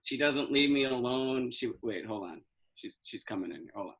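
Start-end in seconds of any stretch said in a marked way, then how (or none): 1.5-3.9 dropping to a hush as mom walks in; sudden hushed aside. Acted. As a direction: quickly lower your voice so she can't hear.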